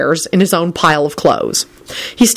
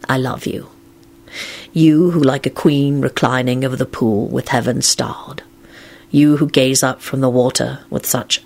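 poetry recital; 3 semitones lower than usual. A narrator's reading. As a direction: recite it tenderly, almost breathless. Reciting a love poem.

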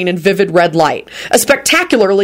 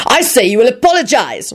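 higher-pitched, insistent demand; venomous; 5 semitones higher than usual. A narrator's reading. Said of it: "reiterated venomously".

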